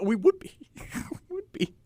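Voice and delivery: high, wheezy laughing words; cracking up while agreeing; breathless laughter. Real, not acted. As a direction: squeeze the words out through giggles. Cracks up agreeing about seeing ghosts.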